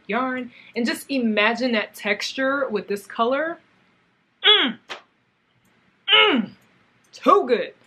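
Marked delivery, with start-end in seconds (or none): 4.4-6.5 high, loud savoring mm; delighted hum. Real, not acted. Vlogger admiring yarn colour to viewers, high savoring hum. 7.1-7.7 loud, high too good; excited. Real, not acted. Vlogger gushing to viewers about the yarn, loud. Admiring yarn, repeated later.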